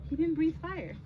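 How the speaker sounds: usual speaking voice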